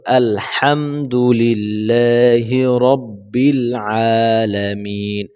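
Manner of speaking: আলহামদুলিল্লাহি with a thin হা; correct tajweed reading. Shown as a demonstration. Teacher demonstrating the correct reading. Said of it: "বলতে হবে কি?".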